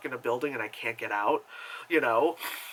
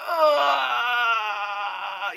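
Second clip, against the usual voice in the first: long, high exasperated groan; drawn-out "ugh" of disgust; 7 semitones higher than usual. Retold or imitated. Viewer reacting to condescending kids, long disgusted groan.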